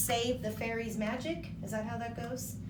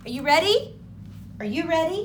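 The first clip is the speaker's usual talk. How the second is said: loud, high hyped-up host call; hype call; 8 semitones higher, 13 dB louder, faster than usual. Real, not acted. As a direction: pump up the crowd. Host hyping the kids.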